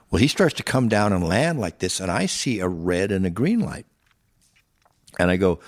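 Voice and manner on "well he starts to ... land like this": ordinary talk, nothing marked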